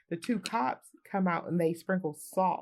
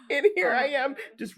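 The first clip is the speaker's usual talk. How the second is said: laughing words, higher and louder; real laughter; 7 semitones higher, 5 dB louder than usual. Real, not acted. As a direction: laugh out the line. Laughing at memory.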